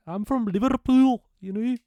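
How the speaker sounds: loud, high-pitched, drawn-out Scouse caricature; mock a Liverpool accent, squealing it high and slow; exaggerated Scouse accent. Shown as a demonstration. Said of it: "the Scouse accent is".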